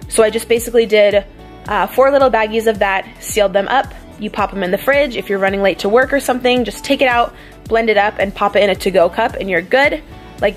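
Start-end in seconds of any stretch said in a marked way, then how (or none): none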